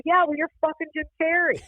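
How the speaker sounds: high-pitched retold comeback; exasperated retort. Guest answering Jim Carrey's praise of the restaurant, exasperated.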